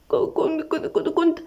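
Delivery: high, soft, broken stammer; frightened crying. A narrator's reading. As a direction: stammer fearfully through tears. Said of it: "أميرة بخوف وهي بتعيط".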